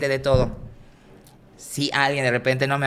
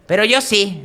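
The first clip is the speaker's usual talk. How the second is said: cheeky punchline; 8 semitones higher, 6 dB louder than usual. Retold or imitated. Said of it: "le digo".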